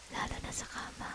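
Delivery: breathy seductive whisper. Acted. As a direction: whisper it close to the ear.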